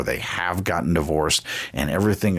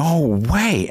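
a lady stunned to see him on a scooter, disbelieving; retold disbelief; 10 semitones higher than usual. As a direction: play her stunned "no way", pitched way up. Lady sees Barnes on scooter.

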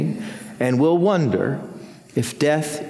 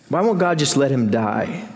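ordinary talk, nothing marked

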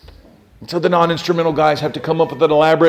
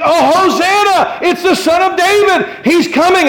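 high, jubilant crowd shout; crying out loud; 13 semitones higher, 4 dB louder than usual. Acted. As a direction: hail the arriving king at full voice. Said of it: "They were crying out loud".

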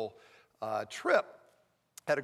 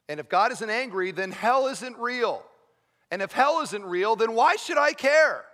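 impassioned preaching; 6 semitones higher, 6 dB louder than usual. Acted. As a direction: build louder and higher into a rhetorical cry. Preaches with rising force.

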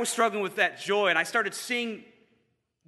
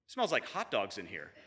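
low, quiet offhand remark; casual aside; 8 semitones lower, 6 dB quieter than usual. Retold or imitated. Friend pausing by the concessions, low casual aside. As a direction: drop it low after a pause. Deadpan aside after a pause.

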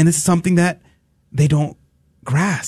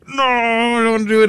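whiny complaining; 10 semitones higher than usual. Retold or imitated. Wimp dreading dental work, whiny complaining. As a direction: whine like a kid refusing. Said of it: "I'm, like, complaining the entire time".

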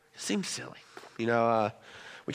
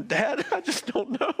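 high-pitched, slow imitation of a crying son; mock sobbing child; 6 dB louder, slower than usual. Son with no solution to tell dad, mock sobbing. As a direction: imitate a kid's tearful wail, half laughing. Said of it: "And he's sobbing".

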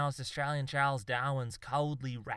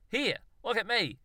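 much higher, showy proclamation; mock announcement; 10 semitones higher, 4 dB louder than usual. A narrator's reading. Zoologist mocks an inventor's boast, announcing it. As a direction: announce it like a self-mocking inventor. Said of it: "he announced".

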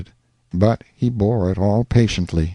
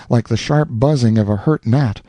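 usual speaking voice

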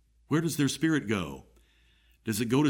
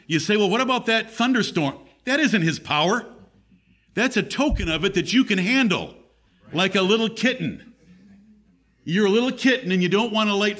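higher-pitched, urgent pulpit delivery; raised preaching; 6 semitones higher, 3 dB louder than usual. Real, not acted. Preacher warns listeners God's thunder is only a token, raised preaching. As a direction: press each point home from the pulpit.

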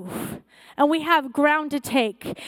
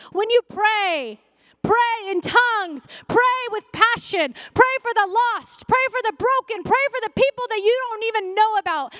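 pleading sermon cry; fervent preaching; 6 semitones higher than usual. Acted. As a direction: exhort them to pray with fire. Pastor urging congregation to pray.

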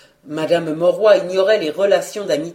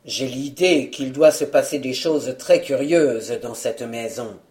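plain reading voice

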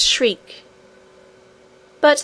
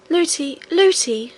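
higher-pitched call, mildly distressed; crying out; 6 semitones higher than usual. A narrator's reading. Princess calls to her nurse, crying out. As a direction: call out to the nurse like an upset child. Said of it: "cried the princess".

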